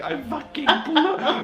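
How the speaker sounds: high, slow wail breaking into laughter; mock crying. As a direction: imitate tearful distress, half laughing. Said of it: "I'm, like, crying and stuff".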